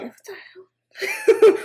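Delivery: high, very slow, laughing; laughing disbelief. Retold or imitated. Puzzled woman reacting to a stranger's yelling, laughing. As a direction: drag it out through a laugh. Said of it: "I'm like".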